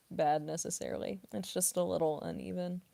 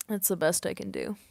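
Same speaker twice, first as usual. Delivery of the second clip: ordinary talk, nothing marked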